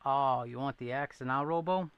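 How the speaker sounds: usual speaking voice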